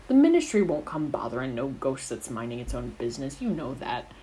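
usual speaking voice

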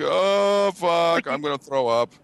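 high, queasy pre-fight groan; mock nervous dread. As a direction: act sick with nerves, pitch up. Acts out dread before fight.

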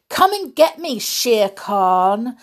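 loud, high cheeky taunt. Acted. Mowgli lures Shere Khan out with a loud taunt. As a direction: dare the tiger to come, mocking. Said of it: "the man cub taunted".

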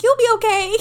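high, loud cooing "You'll be okay."; baby-talk it like to a puppy; cooing to a dog. Retold or imitated. Patting a crying friend, high baby-talk comfort. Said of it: "Like a little dog".

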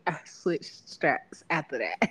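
ordinary talk

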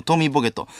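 usual speaking voice

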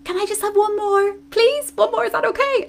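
put-on high, wheedling little-child plea. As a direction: beg sweetly like a child who won't go to bed. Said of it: "I'll be like".

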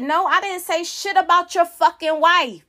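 retold angry comeback, rising in pitch; indignant denial. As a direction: hit back, replaying the argument. Retold quarrel with dad.

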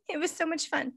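high, laughing giddiness; giddy laughter. Real, not acted. Teller explains her giddy laughter, still laughing. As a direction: laugh through it, too happy to stop. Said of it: "I'm just so excited".

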